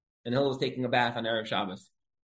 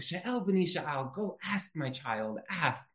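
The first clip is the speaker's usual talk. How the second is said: soft, very drawn-out gentle invitation; slowly coax, voicing an unhurried sage; imitating patient Hillel; 2 semitones higher, 7 dB quieter, much slower than usual. Hillel inviting the questioner to ask, soft and slow. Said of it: "the way he talks, like".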